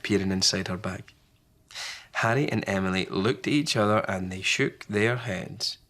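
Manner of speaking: usual speaking voice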